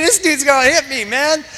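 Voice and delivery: high, loud retold panic breaking into laughter; mock alarm. Pastor recalling fear of the table-banger, laughing mock alarm. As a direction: squeal the thought with comic alarm.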